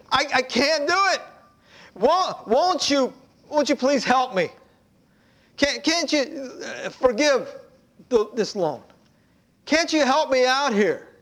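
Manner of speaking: begging debtor. Acted. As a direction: beg the master for help.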